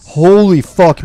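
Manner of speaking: loud, high exasperated swearing; pissed off. Real, not acted. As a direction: burst out, fed up with the game. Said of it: "I haven't been so pissed at the game".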